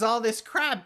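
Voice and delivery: ordinary talk, nothing marked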